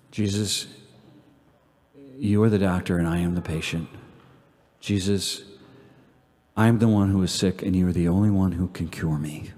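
low, soft, reverent prayer; quiet devotional prayer with long pauses. Real, not acted. Leads closing prayer.

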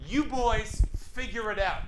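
loud, slow exasperated brush-off; loud dismissive mock. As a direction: dump the boys and storm out. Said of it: "she goes".

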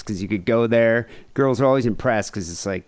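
usual speaking voice

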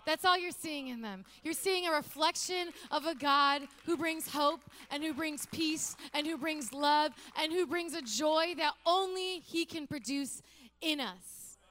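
loud, fervent preaching; preaching at full force. Acted. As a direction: lift it up and preach. Preaching climax.

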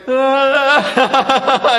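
a playful imitation of the little boy's half-laughing sob; hammed-up baby cry; imitating a toddler's cry. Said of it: "he's like".

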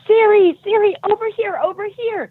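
high, loud retold shout across the pool; mom yelling. Mom calling her daughter out of the pool, loud high yell. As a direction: call out like a frantic mom. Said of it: "And she's yelling and screaming".

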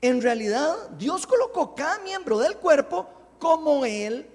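high, loud proclaimed verse; scripture reading. Real, not acted. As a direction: read it out forcefully to the congregation. Reads scripture aloud. (lectura bíblica enérgica, en voz alta)

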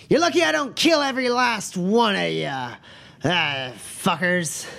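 put-on high, slurred drunken threat; drunk brawler. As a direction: stretch the curse out like a belligerent drunk. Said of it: "brandishing it drunkenly at the bar".

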